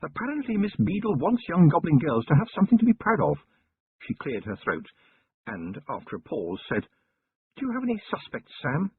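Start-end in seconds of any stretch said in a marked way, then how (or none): 0.0-3.4 curiously chatty. A narrator's reading. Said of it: "in a curiously chatty voice". 7.5-8.9 higher, refined lady's voice, curious; Lady Sybil voice. A narrator's reading. Wife questioning her husband about the goblin girl, higher refined lady voice.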